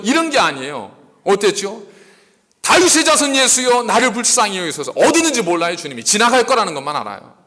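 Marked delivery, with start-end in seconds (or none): 2.6-4.9 high-pitched beggar's plea, urgent; imitated shouting. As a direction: cry out to someone passing by. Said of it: "그러나 소리 지르는 거예요". (지나가는 이를 향해 높게 외치는 소리)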